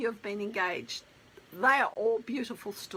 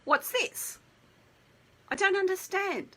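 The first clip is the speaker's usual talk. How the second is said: higher, bewildered fiancée voice, lightly acted; mock confusion; 8 semitones higher than usual. Fiancée puzzled by the letter, mock bewildered. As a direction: play her baffled and a little lost. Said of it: "she goes".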